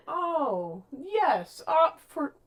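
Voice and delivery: mock-indulgent, pitched high; humouring. Podcaster acting Alice indulging Feyre's excuses, humouring her. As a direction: play Alice sweetly humouring her, voice lifted.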